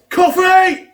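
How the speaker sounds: high, loud shout across the yard; mock waitress yelling out the order; shouted call. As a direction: bellow "Coffee!" out the door. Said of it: "she just shouts".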